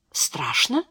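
soft, rising surprised question on «Страшно». A narrator's reading. Hil questions what Beatriz just said, surprised. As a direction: ask «Страшно?» in hushed surprise, pitch lifting at the end. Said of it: "удивлённо спросил Хиль".

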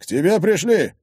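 high, loud announcement; calling out. Acted. Father calling daughter to her visitors, shouting. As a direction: call it through the house. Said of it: "крикнул Егор, обернувшись".

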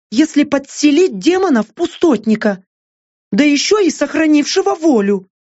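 high female character voice, guessing aloud; Лаура, uncertain. A narrator's reading. Лаура guessing what the demon may do, high and uncertain.

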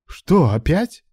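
high, slow alarmed gasp; gasping surprise. A narrator's reading. Alan arriving to see Ruslan collapsed again, gasping. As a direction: gasp it out in shock. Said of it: "ахнул подоспевший Алан".

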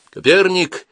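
loud high call of a name; shout. Acted. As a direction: yell the name into the dark. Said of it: "крикнул Саватеев".

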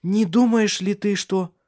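high, slow, hopeful question left hanging; barely contained excitement. A narrator's reading. Woman daring to hope, excitement barely held in. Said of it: "Она с трудом сдерживала волнение".

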